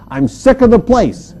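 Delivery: loud, high, exasperated retort; mock-indignant, loud complaint; fed-up outburst. Acted fed-up sick call to boss.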